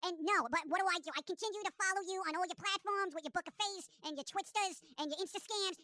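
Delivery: quiet, whiny obsessed friend; clingy stalker voice. Acted. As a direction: nag softly like a clingy stalker. Acts obsessive needy texter.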